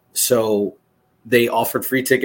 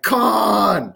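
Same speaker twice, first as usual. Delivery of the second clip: high, drawn-out mock cry; mock dramatic yell; 11 semitones higher than usual. Retelling his yell at Punk, mock dramatic cry. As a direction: stretch it into a hammed-up holler.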